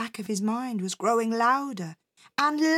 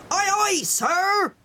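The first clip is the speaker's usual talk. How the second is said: high, loud sailor's bark; smart command; 9 semitones higher, 4 dB louder, slower than usual. A narrator's reading. Captain teaching the new cabin boy, smartly barking it. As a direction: demonstrate the reply, loud and pitched up.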